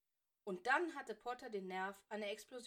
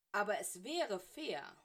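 louder, slow insistence; emphatic protest; 7 dB louder, slower than usual. A narrator's reading. Severus protesting to Snape as he slams the quill down, emphatic protest. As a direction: stretch it out as an indignant objection. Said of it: "knallte die Feder auf den Tisch".

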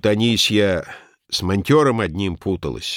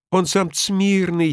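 higher, slow confiding tone; slow, higher mysterious confiding; 10 semitones higher, slower than usual. A narrator's reading. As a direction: let the cook in on a secret. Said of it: "таинственно пояснила она".

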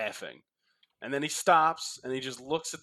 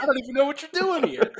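fast, higher, laughing through it; laughing exasperated quote; 10 semitones higher, 4 dB louder, faster than usual. Retold or imitated. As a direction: laugh while playing the fed-up anchor. Voicing Van Pelt, head on desk.

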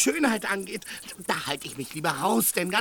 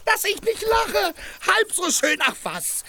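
pitched way up in scoffing disbelief; 13 semitones higher than usual. Acted. Sancho scoffs at Dulcinea.

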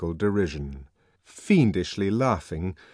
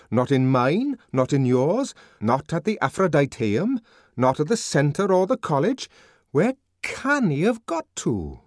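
raised pitch, rising list; perplexed, higher-pitched wondering; puzzled questioning; 7 semitones higher, 4 dB louder than usual. A narrator's reading. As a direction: tick off each place, lifting the ends. Puzzled friend lists places searched.

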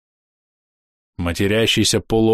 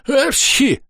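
sudden high-pitched sneeze; stylised high "achoo"; acted sneeze. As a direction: sneeze mid-sentence. Sneeze interrupts his warning.

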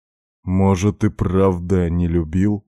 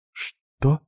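high, startled little question; a touch of breathless surprise, pitched up; breathed question; 9 semitones higher than usual. A narrator's reading. Katya questions being told to sleep, breathed out. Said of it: "выдохнула она".

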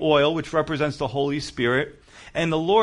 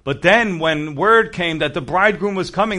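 ordinary delivery

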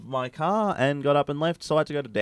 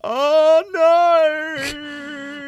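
high, loud mock wail; whiny child wail; 9 dB louder than usual. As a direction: fake a little boy's fall. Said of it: "he went".

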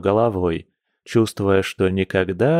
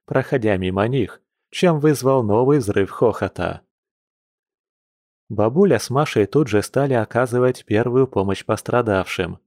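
plain reading voice

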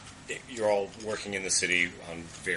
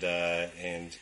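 usual speaking voice

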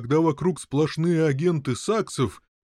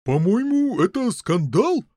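high, louder disapproving knight; indignant; 9 semitones higher, 5 dB louder, slower than usual. A narrator's reading. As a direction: pronounce it a scandal with indignation. (возмущённо)

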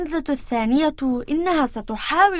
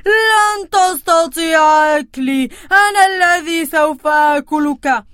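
loud voice; 2 semitones higher, 6 dB louder than usual. Acted. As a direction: defy the troll boldly. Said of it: "بصوت عال".

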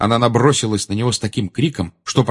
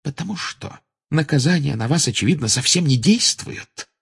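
higher-pitched cutting reply; caustic; 7 semitones higher than usual. A narrator's reading. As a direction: answer with a sting. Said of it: "едко ответила она". (едкий ответ повышенным тоном)

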